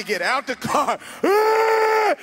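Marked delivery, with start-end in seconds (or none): 1.2-2.1 high, loud battle yell; brother impression. Brother jumping out to fight, high yell.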